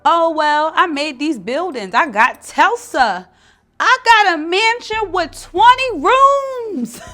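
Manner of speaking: high, loud, slow puffed-up brag; mock boastful rich guy; boastful man. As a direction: ham up a bragging man with his mansion. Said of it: "That's the man's ego".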